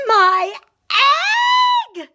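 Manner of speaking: high, loud joyful shout. Acted. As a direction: shout it out in amazement. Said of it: "shouted Horton".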